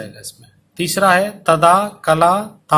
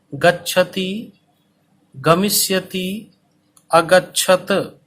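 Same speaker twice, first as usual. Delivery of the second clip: each syllable drawn out very slowly for copying; teacher dictating at a crawl; slow dictation; much slower than usual. Shown as a demonstration. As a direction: spell it out slowly so students can write. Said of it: "इसीलिए धीरे धीरे बोल रहा हूँ".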